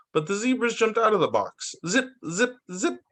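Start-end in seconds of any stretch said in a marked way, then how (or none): 1.9-3.0 high, quick zip noises; zippy sound effect. A narrator's reading. Storyteller voicing the zebras darting off, high zips.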